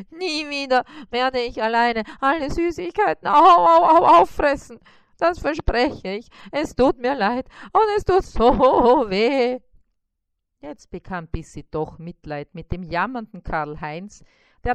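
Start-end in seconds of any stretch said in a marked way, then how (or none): 0.1-9.6 high, loud wailing promise; whimpering spider in pain. Acted. Spider wails his promise to Bissi, high and hurting. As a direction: wail it out, half laughing through the ache.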